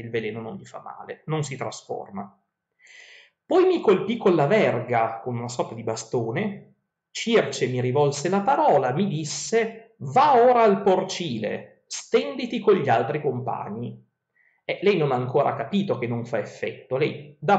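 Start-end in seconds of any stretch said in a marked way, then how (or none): 3.4-14.0 epic verse reading. Acted. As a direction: read the verses like a storyteller. Reads Odyssey verses aloud.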